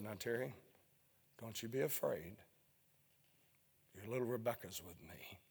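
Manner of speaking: softly repeating the still small voice. Retold or imitated. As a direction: say it tenderly, almost inaudible. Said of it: "in that small, still voice".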